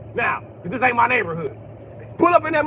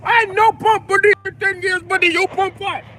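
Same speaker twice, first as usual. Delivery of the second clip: put-on Indian accent; 11 semitones higher, 3 dB louder than usual. Indian cashier correcting the customer's pump, Indian accent. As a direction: insist like a sure Indian cashier. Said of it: "they'll argue with you too, like".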